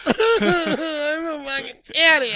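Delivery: high, drawn-out fake sobbing; slow, high blubbering imitation; mock crying. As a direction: wail it like a hammed-up weeping woman. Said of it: "I enjoy a good woman crying".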